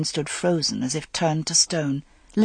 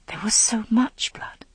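hushed horror; 4 semitones higher, 3 dB quieter than usual. A narrator's reading. Horror at the blood.

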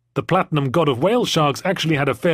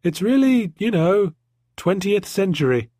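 put-on little girl voice; 7 semitones higher than usual. A narrator's reading. Little girl Jemima continues.

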